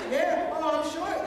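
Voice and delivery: high, loud sarcastic retort; mock-offended comeback. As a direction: throw the insult back loudly. Acted comeback to the teasing.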